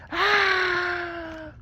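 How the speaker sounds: very high, loud shriek; piercing scream. A narrator's reading. He goes outside and hears her, piercing scream. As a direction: scream like a banshee overhead. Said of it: "all you hear is a scream".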